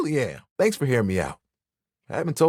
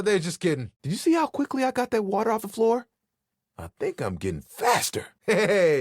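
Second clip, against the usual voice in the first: normal talking voice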